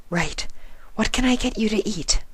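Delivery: high, breathy whisper of a timid pony; gentle, shy, barely daring to ask; Fluttershy's voice. A narrator's reading. Fluttershy businesslike.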